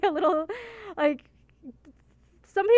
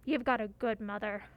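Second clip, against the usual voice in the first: tough bully voice; 2 semitones lower, 4 dB louder than usual. Acted. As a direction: threaten them like a tough bully. Reading Susie's threats in game.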